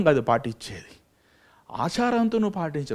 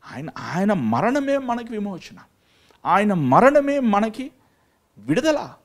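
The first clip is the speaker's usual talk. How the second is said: fervent preaching; 7 semitones higher than usual. Acted. Preacher proclaims the cross's power to his flock, fervent. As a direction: preach each line like a drumbeat.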